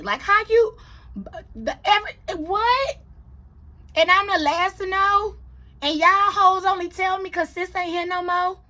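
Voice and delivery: high, loud, stammering disbelief; real high-pitched indignation; indignant disbelief. Real, not acted. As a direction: sputter in offended astonishment. Indignant at being told last.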